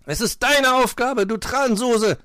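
high, loud telling-off; angry scolding. A narrator's reading. Fox insists it is her job, angry. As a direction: hurl it as an irate reproach. Fox quarrels with lazy girl.

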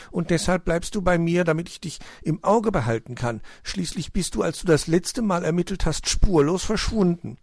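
high-pitched storybook mother laying down the rule; hedgehog mother, gently admonishing. A narrator's reading. Mother keeping her detective child in sight, gently admonishing.

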